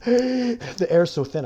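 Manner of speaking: slow, high, loud mock gasping complaint; mock breathless hiker. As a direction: ham up a winded hiker gasping for air. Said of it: "people are like".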